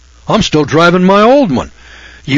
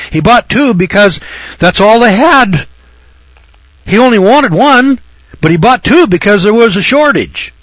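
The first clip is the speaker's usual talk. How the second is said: getting worked up; 8 semitones higher, 4 dB louder than usual. Real, not acted. Worked up over rice hoarding.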